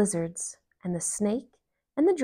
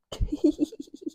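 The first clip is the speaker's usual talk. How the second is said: light breathy giggle; Frog laughs; 7 semitones higher than usual. A narrator's reading. Frog laughing at Toad, a light giggle. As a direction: giggle like a friend who can't help it. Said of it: "And Frog laughed".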